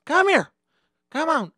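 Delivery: high, loud barked order; angry summons. Retold or imitated. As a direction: call the culprit over sharply. Bus driver summons culprit.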